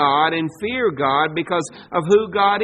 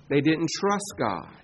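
ordinary delivery

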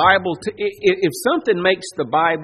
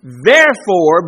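booming emphasis; 10 dB louder, slower than usual. Acted. Climax of quoted command.

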